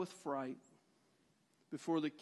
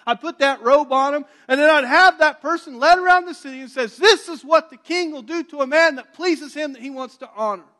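proud loud proclamation; 7 semitones higher, 13 dB louder than usual. Retold or imitated. Haman proclaims honor loudly.

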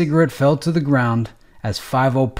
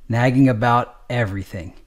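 usual speaking voice

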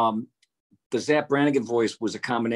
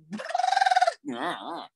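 Zoidberg whoop-whoop laugh. Retold or imitated.

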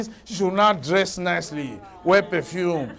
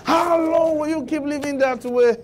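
how long thrown out higher; pitched-up challenge; 7 semitones higher than usual. Retold or imitated. Preacher mockingly challenging the stingy man, pitched up.

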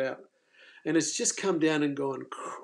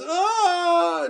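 retold scream; 13 semitones higher, 4 dB louder than usual. Phil screaming, throwing himself back.

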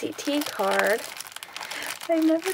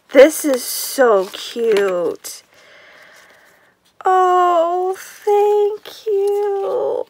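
slow, high, loud gush of thanks; overwhelmed thanks pouring out; real delight, stretched and high; excited gushing; 7 semitones higher, 5 dB louder, much slower than usual. Real, not acted.

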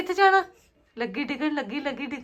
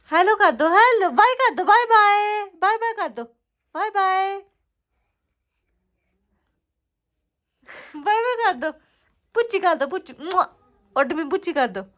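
dramatic loud aunty; 5 semitones higher, 5 dB louder than usual. Real, not acted. Coaxing baby to wave bye.

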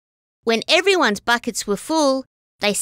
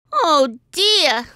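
mild cry; 6 semitones higher, 3 dB louder than usual. Acted. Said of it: "cried Bronte".

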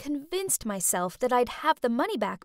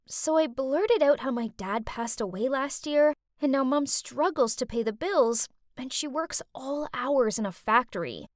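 usual speaking voice

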